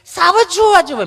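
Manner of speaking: high, over-articulated mock city Gujarati; affected city speaker. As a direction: squeeze out each word in a high, prissy voice. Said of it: "ચીપી ચીપીને Gujarati બોલે".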